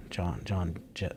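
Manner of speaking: usual speaking voice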